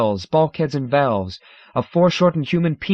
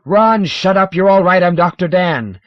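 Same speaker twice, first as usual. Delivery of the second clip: loud higher desperate yell; 7 semitones higher, 9 dB louder than usual. Acted. Dan yelling at panicking Ron, loud and high. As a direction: shout him down to calm him. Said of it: "is also yelling".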